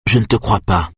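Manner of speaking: understated, determined statement; resolute. A narrator's reading. Simone refusing to believe Aïda, resolute. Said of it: "dit Simone d'une voix résolue".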